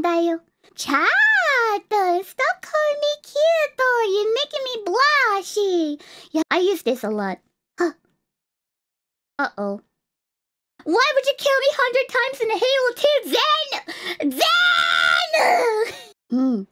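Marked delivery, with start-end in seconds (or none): 0.7-6.0 mock-flustered, high-pitched protest; bashful blushing. Streamer telling chat to stop calling her cute, bashful. 10.8-15.9 high, fast whiny protest, drawing out the name; mock-indignant outrage. Streamer blaming Zen for killing her in Halo, mock outrage. Acting out a wronged outburst.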